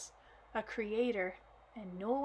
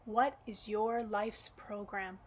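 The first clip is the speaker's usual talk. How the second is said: usual speaking voice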